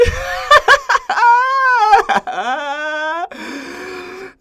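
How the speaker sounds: high, loud, drawn-out cackle; unhinged high-pitched laughing fit; maniacal laugh. Acted. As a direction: cackle wildly with sadistic glee. Gloats over killing ants.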